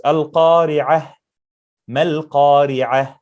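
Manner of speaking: slow, Al-Qari'ah and Mal-Qari'ah with the final ha breathed; breathy final ha. Shown as a demonstration. Teacher shows the required ha. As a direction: stretch it out and breathe the closing h. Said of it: "ha is a must".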